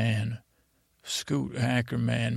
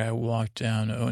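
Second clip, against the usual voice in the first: plain reading voice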